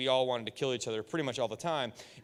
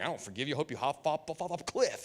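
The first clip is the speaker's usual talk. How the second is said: quiet, grumbling inner voice; a bitter aside to yourself; muttered grudge; 6 dB quieter than usual. Retold or imitated. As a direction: mutter the grudge under your breath. Inner grudging voice while faking forgiveness.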